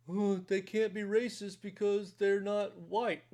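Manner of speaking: slow, put-on mocking voice; mocking objector. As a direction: mimic a silly objection, drawn out. Mimicking objectors he then dismisses.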